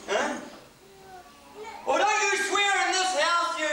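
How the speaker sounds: mock-angry house-rule outburst; scolding parent. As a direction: shout it like a parent laying down the law. Parent scolding child in example.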